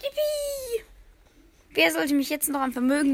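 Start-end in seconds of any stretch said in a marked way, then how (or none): 0.0-0.8 very high squeal of joy; excited cheer. Acted. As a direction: whoop like a thrilled child. Excited Midas turns branch gold.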